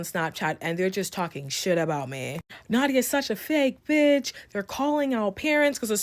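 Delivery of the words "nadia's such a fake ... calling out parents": higher-pitched imitation of catty group chat; mock gossip. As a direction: mimic peers complaining behind someone's back, pitched up. Voicing group chat gossip about her.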